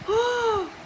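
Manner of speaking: sharp high shocked gasp; a quick startled intake of breath. Acted.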